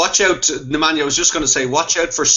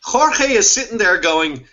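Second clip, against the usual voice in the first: usual speaking voice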